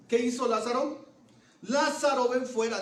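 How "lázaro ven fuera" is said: raised, higher voice calling a name into the tomb; loud call. Retold or imitated. Jesus calling dead Lazarus out of the tomb, loud call. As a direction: call Lázaro out, loud and high. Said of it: "Jesús grita con todas sus fuerzas".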